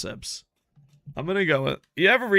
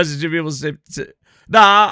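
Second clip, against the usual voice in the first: ordinary talk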